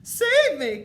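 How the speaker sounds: very high, loud shriek; terrified wailing witch; desperate cry. A narrator's reading. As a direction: shriek for rescue. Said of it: "cried the high wi".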